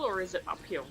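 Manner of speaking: ordinary talk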